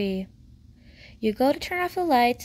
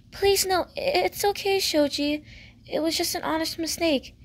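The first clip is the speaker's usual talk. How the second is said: high-pitched, pleading reassurance; higher girl's voice, soothing and insistent; reassuring girl; 6 semitones higher than usual. Acted. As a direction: lift the voice high to stop his apology. Girl hurriedly reassures apologizing neighbour.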